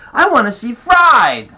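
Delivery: high, loud, stretched-out demand; excited shout. A narrator's reading. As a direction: shout your pick like an eager kid. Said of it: "the pain shouted".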